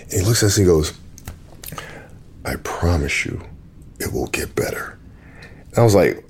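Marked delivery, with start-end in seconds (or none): 2.4-5.0 imitating a hushed, low promise; quiet reassurance. Composer turning to two onlookers after playing, hushed reassurance. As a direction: drop to a near-whisper to quote him.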